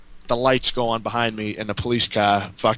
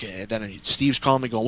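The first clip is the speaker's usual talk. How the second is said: ordinary talk, nothing marked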